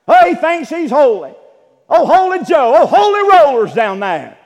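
high, loud sneering mockery. Preacher voicing the world jeering at churchgoers, loud mocking sneer. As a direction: ham up the world jeering at holy folk.

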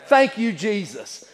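slow, drawn-out thanksgiving; slow preacher's thanks; emphatic thanks. Acted. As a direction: stretch each word out as heartfelt praise. Repeats thanks emphatically, second take.

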